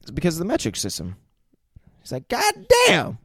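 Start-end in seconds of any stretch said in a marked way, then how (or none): 2.3-3.2 high exclaiming. Retold or imitated. Jackson quote retold.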